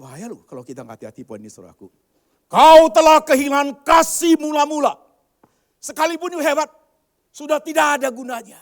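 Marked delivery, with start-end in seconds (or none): 2.5-5.0 slow, booming preacher's shout; thundering rebuke. Acted. Preacher booming God's verdict at the church. 5.8-8.6 loud, high preaching shout; preaching at full force. Acted. Pastor shouting a warning at the congregation.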